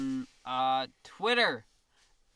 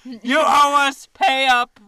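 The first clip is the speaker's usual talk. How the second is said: higher, louder, hammed-up demand; mock IRS caller; 8 semitones higher, 12 dB louder than usual. Cohost joins the scam skit, loud hammed-up demand. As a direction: shout the demand in fake menace.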